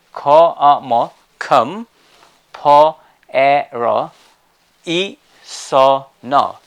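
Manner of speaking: comparison broken into loud separate syllables. Shown as a demonstration. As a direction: sound out "Ka, a, mo, kum, po, e, ro, i, so, no" one by one. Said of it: "make it correct?".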